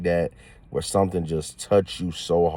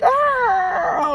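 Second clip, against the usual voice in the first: very high squeal of joy; sudden delighted shriek; excited squeal. Retold or imitated. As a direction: let out a giddy shriek. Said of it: "I'm, like, losing my cool".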